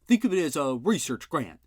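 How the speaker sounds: an insulted uncle telling off the sergeant; gruff indignant uncle. A narrator's reading. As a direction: snap back at a dim cop.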